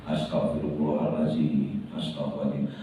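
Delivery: low, soft repeated prayer; murmured istighfar. Shown as a demonstration. Preacher showing private repentance in a dark room, murmured. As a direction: drop low and murmur as if praying alone. Kita istighfar sebanyak-banyaknya.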